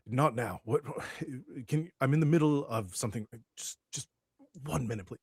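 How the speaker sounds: harried boss put off mid-argument; mildly exasperated. Acted. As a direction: wave off an interruption, stumbling over words. Boss interrupted mid-argument by security.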